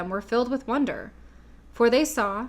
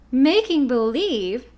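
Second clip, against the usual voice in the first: slow, louder disbelieving echo; surprised cry; 3 semitones higher, 6 dB louder, slower than usual. A narrator's reading. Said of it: "cried Dorothy".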